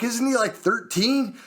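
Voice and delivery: high, incredulous question; appalled. Retold or imitated. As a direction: retell it in disbelief. Retold alarm about child at home.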